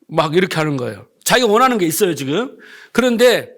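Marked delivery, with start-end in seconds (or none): none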